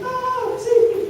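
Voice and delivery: high, yelled plea for rescue; a panicked shriek for help; mock drowning cry. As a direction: cry out like a man going under. Peter drowning cries out.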